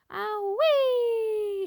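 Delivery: high, loud, drawn-out whooping scream; screamed ow-wee. A narrator's reading. Grandma screaming with the dose. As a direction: whoop like a rocket launching. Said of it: "she screamed".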